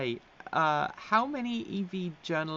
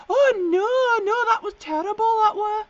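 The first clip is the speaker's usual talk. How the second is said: very high, loud northern colleague; northern accent; 11 dB louder, slower than usual. Retold or imitated. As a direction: wail it in an exaggerated northern English accent. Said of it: "mimic the accent".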